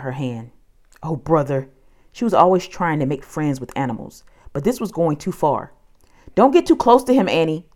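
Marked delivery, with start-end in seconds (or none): 6.4-7.7 loud, high warning shout. A narrator's reading. Jack warning Annie near the creature, loud shout. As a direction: yell a warning. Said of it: "Jack shouted".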